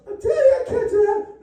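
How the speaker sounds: high, whiny mock daughter; girly daughter voice. Comic telling Ivanka joke, squeaky high-pitched daughter. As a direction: squeak it out like a pleading teen girl. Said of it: "And she goes".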